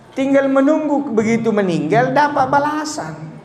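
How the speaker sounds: ordinary delivery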